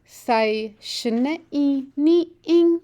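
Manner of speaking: "Thai shne i ni ing" said slowly, each syllable spaced, pitch lifting on the tones; slow model sentence. Shown as a demonstration. Teacher models a Shoshone sentence for the class, slow and careful. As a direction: model it slowly for learners to copy. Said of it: "so repeat the sentence after me now".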